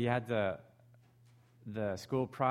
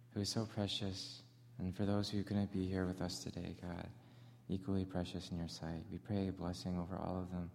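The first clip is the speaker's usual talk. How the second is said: prayer; 7 semitones lower, 7 dB quieter than usual. Acted. Pastor leading the congregation in opening prayer, hushed and low. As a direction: pray it quietly and humbly.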